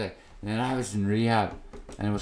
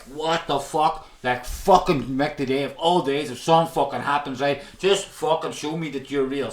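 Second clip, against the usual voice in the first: raised, pleading mock prayer to God; half-acted angry plea, higher in pitch; retold angry prayer; 4 semitones higher, 4 dB louder than usual. On his knees in rehab, retelling an angry plea to God. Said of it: "I was swearing and cursing, and I was begging".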